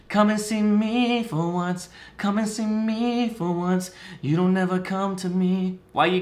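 high, slow, whiny plea; mock pleading lover. Mocking a lover begging her to come over, high pleading voice. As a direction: beg in a put-on high voice, drawn out.

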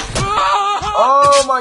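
loud high oh my; shocked shout. Real, not acted. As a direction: cry out in shock. Shout at falling.

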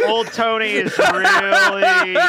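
slow, loud, strained cry; mock dramatic wail. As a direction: ham up the agony of choosing. Player dramatizing Tony's indecision.

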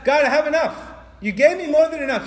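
high, loud proclamation; thankful declaration to God. Acted. Preacher voicing a grateful eater telling God, loud declaration. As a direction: call it up to God with full voice. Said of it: "is telling God".

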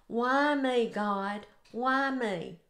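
normal talking voice

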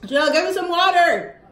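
calling out. Real, not acted. Calling again for water.

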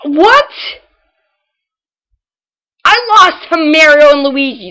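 loud, high and indignant; loud disbelief. Real, not acted. Lost to easy opponents.

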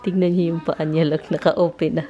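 ordinary delivery

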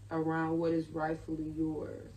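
normal talking voice

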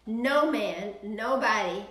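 ordinary talk

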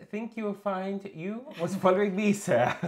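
high, pompous mock politeness; mock posh gentleman. Streamer reading a chat message as a posh gentleman. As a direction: read it like a prim gentleman correcting someone.

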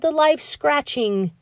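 plain reading voice

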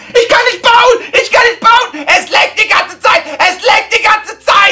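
high, loud, whining yell of a hopeless player; mock whiny gamer. Imagining his own Fortnite novel, a mock whiny shouting gamer. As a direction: wail it like a kid who can't build in Fortnite. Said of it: "dann würde es ungefähr so aussehen".

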